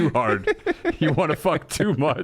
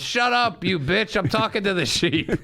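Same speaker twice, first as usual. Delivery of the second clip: put-on drunk farmer voice; 8 semitones higher than usual. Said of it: "He goes".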